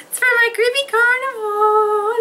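usual speaking voice

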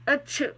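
a sudden loud real sneeze, high pitched. Real, not acted. Sneezing after looking into a bright light, real sneeze. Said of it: "it works too much".

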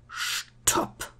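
eerie, drawn-out whisper; soft voiceless whisper. A narrator's reading. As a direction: whisper it as a hushed, carrying command. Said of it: "flüsterte der Mann".

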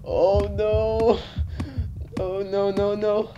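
high, fearful "oh no"; scared. Real, not acted. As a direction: shrink back from what just frightened you. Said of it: "That scared the shit out of me".

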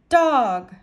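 loud, croaking parrot. A narrator's reading. Parrot perched on the dog's head, loud croak. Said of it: "she croaked".

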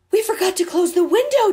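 loud, high, fast alarmed cry. A narrator's reading. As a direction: blurt it out in a panic. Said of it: "Debbie said".

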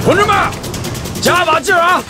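rallying shout. Acted. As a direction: urge the whole crew on at full voice. Rallying comrades digging trenches. (高声喊着给同志们鼓劲)

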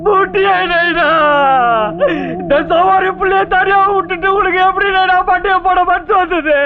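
theatrical sobbing wail. Acted. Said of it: "எப்படி அழறான் பாருங்க".

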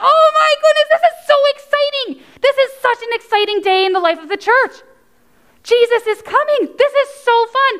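high-pitched, thrilled exclamations; gushing excitement. Pretend. Teacher hyping children for Jesus coming, thrilled. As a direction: hype it up, voice way up high.

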